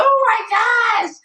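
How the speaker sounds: high, slow, breathless; overjoyed. Retold or imitated. To her realtor on the phone, high and overjoyed. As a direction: gasp it out in delight. Overwhelmed with joy.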